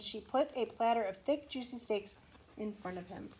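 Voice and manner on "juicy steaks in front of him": plain reading voice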